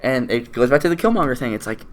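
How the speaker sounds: ordinary delivery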